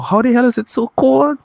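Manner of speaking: very high, baffled complaint; incredulous. Retold or imitated. Caribbean visitor stunned by London's cold, high-pitched incredulity. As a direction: squeal it in comic disbelief. Acts out his disbelief at cold.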